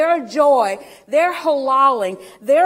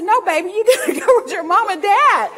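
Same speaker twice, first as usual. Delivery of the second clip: higher and louder, laughing through gentle words; laughing tenderly; 9 semitones higher, 5 dB louder than usual. Retold or imitated. Preacher tells the toddler to go with her mom and dad, laughing. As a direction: coax the little girl home with a laugh. Comforting toddler who won't leave.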